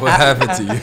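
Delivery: loud, laughing cry of disbelief; mock-dismayed shout. Mock-dismayed echo of the line.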